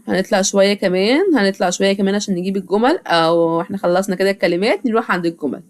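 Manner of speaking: normal talking voice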